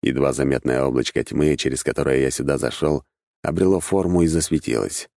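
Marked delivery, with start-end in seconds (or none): none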